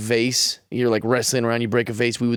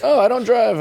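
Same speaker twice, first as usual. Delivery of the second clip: higher, jumpy mock denial; nervous denial; 9 semitones higher, 4 dB louder than usual. Retelling a panicked lie to the cop, higher and jumpy. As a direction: play dumb in a panicky rush.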